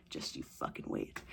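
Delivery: a breathy whispered threat; ominous whispered joke; mock threat whisper. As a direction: hiss it like a warning.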